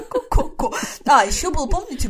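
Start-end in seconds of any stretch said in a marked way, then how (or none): 0.0-0.7 hen clucking. Pretend. Clucking echo.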